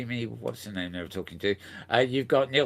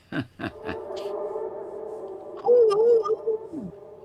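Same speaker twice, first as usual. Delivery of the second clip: high, loud mock-spooky hoot; laugh into wail; 6 dB louder than usual. Host voices the music for the ghost segment, high wail. Sound after cueing the music.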